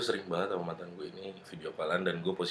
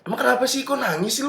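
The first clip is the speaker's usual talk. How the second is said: pitched up in rising alarm; higher, worried question; 14 semitones higher, 4 dB louder than usual. Retold or imitated. Narrator quotes himself asking his crying girlfriend, worried. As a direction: ask her anxiously, voice going up.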